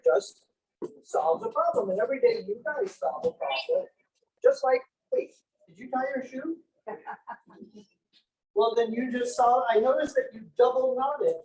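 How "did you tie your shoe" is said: ordinary talk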